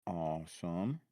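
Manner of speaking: ordinary talk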